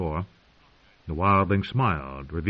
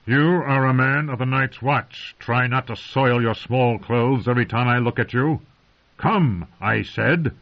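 slow, louder stern rebuke; withering scorn; 5 semitones higher, 5 dB louder than usual. A narrator's reading. Commander scorning a frightened recruit, slow and withering. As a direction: cut the frightened boy down to size. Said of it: "withering look".

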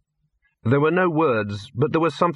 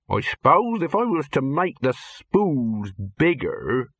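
slow character voice, thinking aloud; thoughtful; 3 semitones higher, slower than usual. A narrator's reading. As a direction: work it out gradually as you speak. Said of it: "He looked thoughtful".

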